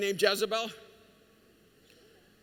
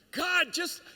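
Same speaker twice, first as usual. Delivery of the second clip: much higher and louder, begging God for a date; lovesick pleading prayer; 9 semitones higher, 5 dB louder than usual. Retold or imitated. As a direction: plead like a desperate teenager.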